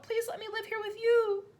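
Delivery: put-on high begging girl; pleading child. A narrator's reading. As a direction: beg with all her heart. Matilda voiced.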